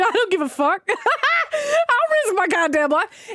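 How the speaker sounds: high, laughing bravado; words pushed out through real laughter. Real, not acted. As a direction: crack up while declaring it, drawn out. Cracks up retelling her bravado.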